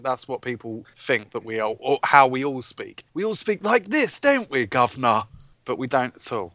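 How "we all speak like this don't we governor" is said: high, put-on "governor" British accent; mock British accent as foreigners imagine it; cheeky caricature of a British accent. Shown as a demonstration. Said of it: "when you think of a British accent".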